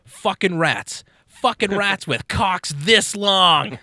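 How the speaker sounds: put-on high, loud, slow kid's shout; little Johnny shouting his answer to the class, loud and drawn out; Johnny yelling. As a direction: belt the punchline like a gleeful brat.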